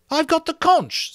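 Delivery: high, hurt protest. A narrator's reading. Piggy to Ralph, hurt and pleading. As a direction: plead to be heard, wounded. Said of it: "in a hurt voice".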